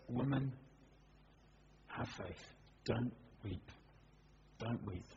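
soft, low, near-whispered reassurance; gentle comfort. A narrator's reading. Jesus comforting the weeping mother, softly and gently. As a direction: hush her grief with quiet tenderness. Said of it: "something in the way that he says it".

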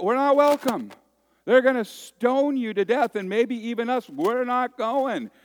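high, loud, pleading whine; high frantic protest, slowing on not going; whiny scared disciples. Retold or imitated. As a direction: beg Jesus not to go, panicky. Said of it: "his disciples are going".

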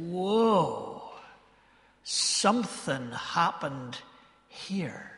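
slow, hushed mock amazement; retold awe. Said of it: "he just looked back up and said".

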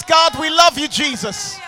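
usual speaking voice

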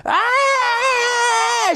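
piercing high scream; woman's scream. Retold or imitated. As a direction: shriek in sudden panic. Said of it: "started screaming at the top of her lungs".

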